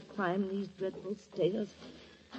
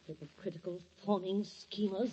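bitter hissed insult; 9 dB quieter than usual. Real, not acted. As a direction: spit the insult under your breath.

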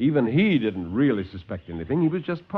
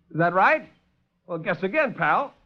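higher, cocky challenge; tough taunt; 6 semitones higher than usual. Acted. Lieutenant taunts the doctor, cocky and high. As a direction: sneer like a hard-boiled hero.

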